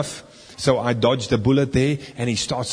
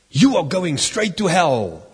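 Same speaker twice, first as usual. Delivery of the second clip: raised, drawn-out mock damnation; imitated stern condemnation; 5 semitones higher, 4 dB louder than usual. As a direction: pronounce doom on him slowly, pitched up. Elder confronts drunk man, acted out.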